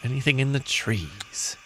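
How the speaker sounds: quiet, trailing plea; soft repeat. Acted. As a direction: ask it under your voice. Repeats the appeal more softly.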